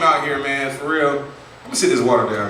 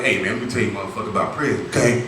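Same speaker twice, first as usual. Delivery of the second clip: lower ex-con voice; 5 semitones lower than usual. Retold or imitated. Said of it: "he's like".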